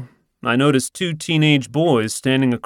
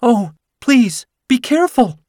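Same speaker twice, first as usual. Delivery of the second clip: much higher, pleading woman's voice; insisting woman; 13 semitones higher, 6 dB louder than usual. Acted. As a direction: beg them to be careful. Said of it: "she insists".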